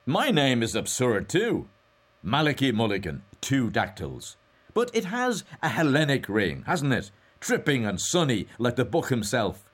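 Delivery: high, loud, lilting good cheer. A narrator's reading. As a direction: carry on gaily at full voice.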